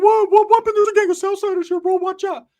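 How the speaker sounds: high, loud warning; Ricky's alarmed voice. Retold or imitated. Ricky warning about the Southsiders in a high, loud voice. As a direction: squeal out the danger. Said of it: "Ricky's like".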